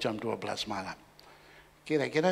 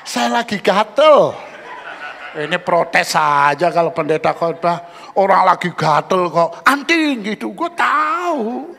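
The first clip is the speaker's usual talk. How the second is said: loud, high, laughing teasing; laughing loud banter; 8 semitones higher, 13 dB louder, slower than usual. Real, not acted. Pastor jokes with the congregation about itching ears, laughing loudly. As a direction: josh the crowd between laughs. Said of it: "masa saya goblok banget".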